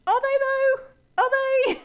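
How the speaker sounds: very high, loud, drawn-out questioning; incredulous challenge. Real, not acted. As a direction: push the doubt high and loud. Sarcastically challenges an anti-fat claim.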